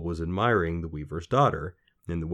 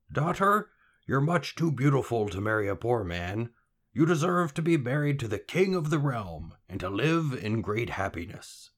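lightly voiced weaver, pitched up at first; fond father figure; 5 semitones higher than usual. A narrator's reading. Weaver telling his daughter she deserves a king, lightly voiced father. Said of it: "look at her and sigh".

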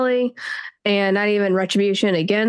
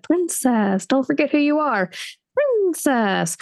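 lightly voiced horse head; 4 semitones higher than usual. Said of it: "the dead horse head talked back and said".

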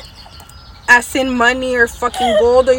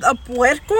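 incredulous; 5 semitones higher than usual. Retold or imitated. Daughter to dad about the pig, higher and laughing in disbelief. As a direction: react like dad has lost his mind.

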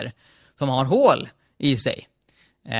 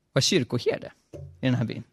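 plain reading voice

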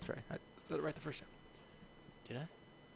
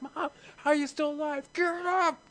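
much higher, disbelieving shout; shocked son; 11 semitones higher than usual. Acted. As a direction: yelp it in total shock. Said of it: "I'm like".

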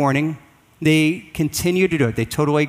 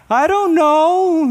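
put-on whiny child; 13 semitones higher, 4 dB louder, much slower than usual. Child's reply to parent.

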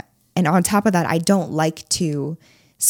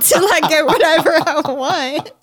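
laughing; 6 semitones higher, 6 dB louder than usual. Real, not acted. Teasing punchline about his fake zen.

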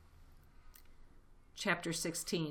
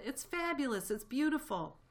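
breathless rapture; 8 semitones higher than usual. A narrator's reading. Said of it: "fighting for breath".

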